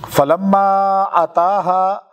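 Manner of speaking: slow, drawn-out Quran chant; Quranic recitation. Acted. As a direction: recite the verse slowly and melodically. Quran verse recited in sermon. (آہستہ، کھینچ کر قرآنی تلاوت)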